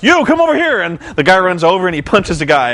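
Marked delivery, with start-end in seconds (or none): 0.0-0.8 high, mock-commanding Hogan call; Hogan beckoning. Hogan calling a wrestler over, mock-commanding. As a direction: wave a guy over like a lazy boss. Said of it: "and he's like".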